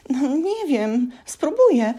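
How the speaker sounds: high, slow, uncertain elf; frightened elf tries to stop shaking; timid squeak. A narrator's reading. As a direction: answer meekly in a tiny voice. Scared elf.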